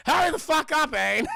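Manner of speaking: loud, high mock yell; impatient shout. Urging Aang to get moving, impatient mock shout. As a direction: holler it at a dawdler.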